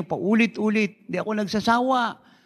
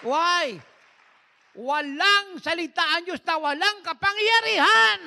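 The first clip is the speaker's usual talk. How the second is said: fervent preaching; 10 semitones higher, slower than usual. Real, not acted. Preacher's emphatic peak.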